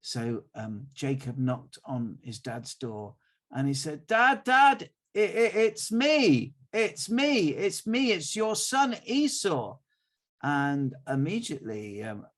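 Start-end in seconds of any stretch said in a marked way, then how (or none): 4.1-9.8 high, loud nervous son calling his dad; pleading in his own voice, clearly higher; Jacob's own voice. Acted. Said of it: "it doesn't sound like Esau".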